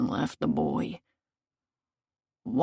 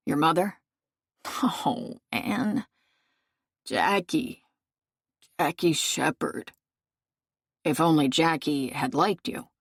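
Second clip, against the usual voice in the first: ordinary delivery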